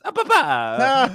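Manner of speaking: high, loud, drawn-out mascot cry; Charles Martinet as Mario. Retold or imitated. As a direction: call out adoringly, stretching each syllable. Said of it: "Charles Martinet is referring to Shigeru Miyamoto-san as".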